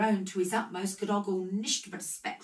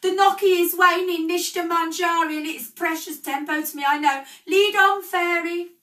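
shrill high screech, much louder; 12 semitones higher, 12 dB louder than usual. A narrator's reading. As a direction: screech the command with all your force. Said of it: "screeched Scrooge".

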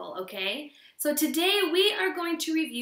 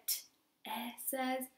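much softer, barely voiced "T, it says"; saying it in my head; 11 dB quieter than usual. Shown as a demonstration. Teacher showing kids how to sound out silently. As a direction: drop to a hush as if only thinking it. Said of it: "I can do this in my head".

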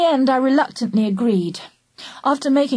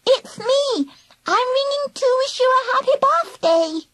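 put-on high warbling toddler voice; babyish, sing-song squeak with a lisp; lisping three-year-old. A narrator's reading. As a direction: warble it like a lisping little child. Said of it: "lisping like a three-year-old".

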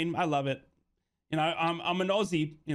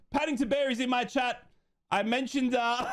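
high voice, talking through laughter; real amused laughter; 9 semitones higher than usual. Real, not acted. Streamer telling viewers Paddington Bear is in chat, laughing. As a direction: try to explain while still giggling.

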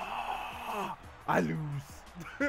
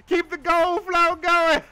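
laughing; 7 semitones higher, 4 dB louder, slower than usual. Real, not acted. Laughing while scoring.

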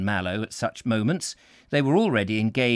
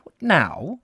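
lightly voiced canon, surprised; a touch of surprise from a vague old priest; 4 semitones higher, 4 dB louder than usual. A narrator's reading. Canon surprised at ringing the station now.

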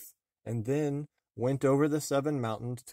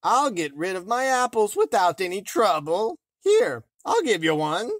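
put-on disguised old woman; 9 semitones higher, 10 dB louder than usual. A narrator's reading. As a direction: croak it sweetly as a disguised hag. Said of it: "answered the farmer's wife".

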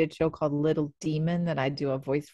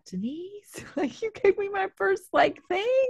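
high-pitched gushing disbelief; overwhelmed, high excited gush. Retold or imitated. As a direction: squeal it at someone you adore. Retold gushing to casting director.

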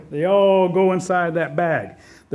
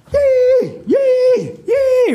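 very high, loud squealed whoops; dancing Rabbit whoops; 7 dB louder, much slower than usual. A narrator's reading. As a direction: squeal out long piercing cries as you dance. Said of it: "Rabbit begins to dance".

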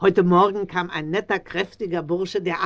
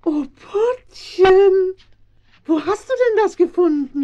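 delighted surprise; 11 semitones higher, 6 dB louder than usual. Acted. Old Frau Schmidt sees the medallion, delighted surprise. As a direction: gasp with joy at the find.

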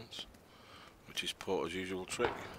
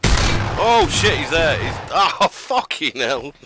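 excited shout; 8 semitones higher, 15 dB louder, much slower than usual. Real, not acted. Spots enemy, shouts in surprise.

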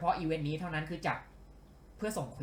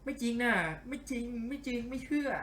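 high, hammed-up disbelief; mock denial; 8 semitones higher than usual. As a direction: refuse to believe it, pitched up. Disappointed by temporary item, mock denial. (แกล้งโวยว่าไม่เชื่อ เสียงสูง)

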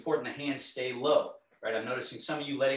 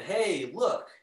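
excited show-off; 6 semitones higher, 5 dB louder than usual. Retold or imitated. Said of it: "if I went to a party and said".

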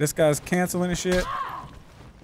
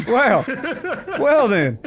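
laughing; 7 semitones higher, much slower than usual. Real, not acted. Laughs at the answer.

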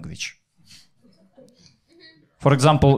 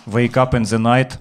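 normal talking voice

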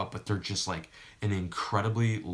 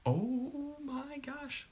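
long high squeal, drawn out slowly; excited squeal; 6 dB quieter, much slower than usual. Real, not acted. Squealing as stream goes live.

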